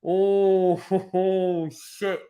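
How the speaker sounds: high, disbelieving laugh; stunned laughing. Real, not acted. Reacting to the second goal, real shocked laughter.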